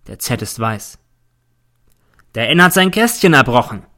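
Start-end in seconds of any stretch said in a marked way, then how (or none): 2.3-3.8 loud, high call across the camp; loud urgent report. A narrator's reading. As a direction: sergeant calling out to the teacher. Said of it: "ruft mir der Feldwebel zu".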